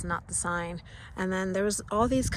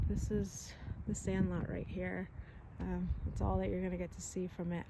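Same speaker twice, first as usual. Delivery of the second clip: quiet; 9 dB quieter than usual. Real, not acted. Hushed near a private property.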